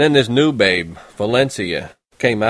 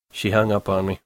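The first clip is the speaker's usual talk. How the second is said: usual speaking voice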